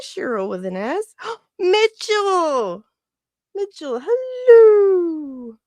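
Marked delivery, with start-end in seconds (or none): 1.6-5.6 high, loud, slow drawn-out hello; gasping, drawn-out delighted hello; delighted greeting. Real, not acted. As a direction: stretch out the welcome with joy. Streamer greets viewer in chat.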